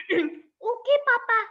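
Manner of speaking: put-on high small child voice; little cub voice. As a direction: speak as a tiny obedient cub. Cub answers father.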